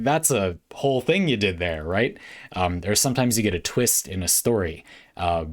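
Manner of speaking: ordinary talk, nothing marked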